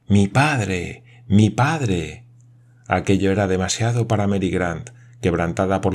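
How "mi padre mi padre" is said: plain reading voice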